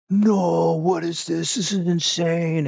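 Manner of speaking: hammed-up astonished gamer cry; mock amazement. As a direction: wail in overwhelmed awe. Acts out amazement at huge bosses.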